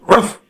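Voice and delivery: a short mock dog bark, lightly done. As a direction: bark once like a dog, half-joking. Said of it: "He barked at the P E teacher".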